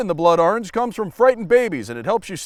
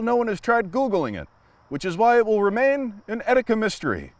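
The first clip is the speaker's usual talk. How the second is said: usual speaking voice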